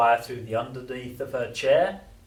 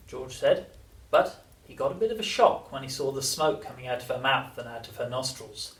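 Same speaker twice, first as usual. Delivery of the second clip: usual speaking voice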